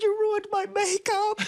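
high, drawn-out comic wail; mock tearful wail. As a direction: re-enact crying at husband, breaking into laughter. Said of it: "And I started crying".

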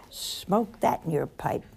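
ordinary talk

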